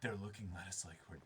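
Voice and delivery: fast, hushed whisper; nervous whisper. Acted. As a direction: whisper it so they can't hear. Acted aside between nervous characters.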